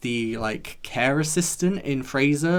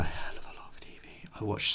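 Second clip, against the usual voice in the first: whisper; 3 semitones lower, 11 dB quieter than usual. Real, not acted. Confessional aside to viewers.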